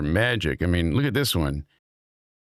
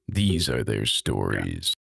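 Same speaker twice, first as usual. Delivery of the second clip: slow, low TV intro voice; Law and Order narrator; 11 semitones lower, slower than usual. Acted. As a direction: intone it low and slow like a show opening. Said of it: "how the Law and Order episode begins".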